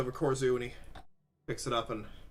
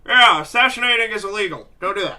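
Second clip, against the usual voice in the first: higher, louder interjection in character; Lorgus; 4 semitones higher, 8 dB louder than usual. Acted. Game master as a character objecting to assassination, loud warning. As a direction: cut in loudly with a warning, then ease off. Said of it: "you see Lorgus look up".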